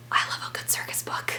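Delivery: breathy secret whisper of delight. Real, not acted. A vlogger confides her love of circus books in a whisper. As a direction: lean in and whisper it like a confession.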